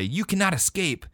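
dramatic game-dialogue declaration; mock heroic yell. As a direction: call out a hammy warning. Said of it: "you yell at one of the bad guys".

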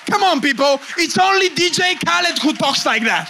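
loud, high preacher's shout; full-force preaching. Real, not acted. Preacher chides his congregation, shouting from the pulpit.

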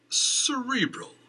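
high, slow, drawn-out Cerebral; rising in annoyance. Acted. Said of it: "his voice rising in annoyance".

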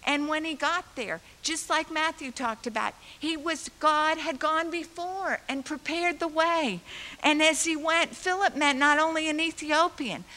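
loud, high fervent preaching. Real, not acted. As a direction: build the sermon to full force. Preaching climax.

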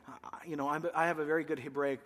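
normal talking voice

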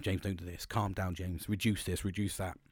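quietly mocking the advice to calm down; imitating calm advice. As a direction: mimic people telling you to calm down. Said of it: "people can tell me all the time".

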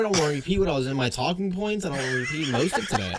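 laughing while refusing; laughing through it. Real, not acted. Cohost refusing to repeat his story, laughing. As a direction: talk through real giggles. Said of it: "refusing while laughing".

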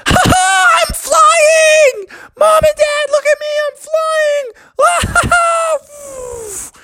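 very high gleeful squeals and laughter; overjoyed shouting. Acted. Froggy calling to Mom and Dad while flying, high gleeful shout. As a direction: shriek with joy in a tiny high voice. Froggy flies for first time.

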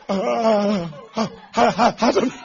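mock-trembling, broken answer; shaking, tearful stammer. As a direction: stutter as if about to cry. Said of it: "That's how you start shaking".

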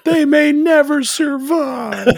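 high, slow hammed-up wail; mock melodrama. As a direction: wail the doom, laughing at the end.

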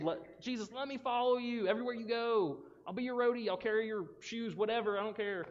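high-pitched, eager begging; acted pleading. Healed man begging to follow Jesus, acted pleading. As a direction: beg like a hammed-up devoted follower. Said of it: "he begged and pleaded".

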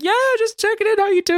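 very high, breezy phone greeting; casual check-in, hammed high; producer on phone. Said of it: "She's like".

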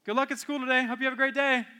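a hint of a cheery parent at the school gate; breezy parent send-off. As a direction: toss it off like a hurried parent in the car. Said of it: "or is it".

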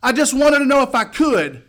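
high, loud protest; shouted refusal. Retold or imitated. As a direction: shout back your refusal. Shouted refusal ending excited exchange.

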